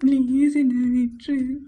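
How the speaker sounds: crying, stretched-out begging; weepy, helpless pleading; tearful plea. Acted. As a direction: beg through sobs to be released. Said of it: "ஏன்டி அழுகுற?".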